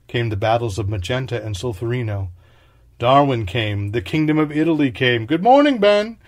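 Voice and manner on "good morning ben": higher and louder cheerful hail; bright call. Acted. Narrator calling a bright good morning to Ben.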